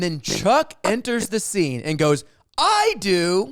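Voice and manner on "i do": high, loud butting-in cry; imitated eager announcement. Drunk guest interrupting the dinner, loud eager imitation. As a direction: burst in with the answer, imitated. Chuck bursts into the scene.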